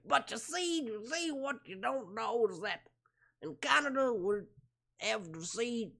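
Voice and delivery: high, wobbly mock-Canadian impression; Bill Cosby. Streamer attempting Canadian version of comedian, high impression. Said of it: "Bill Cosby's like".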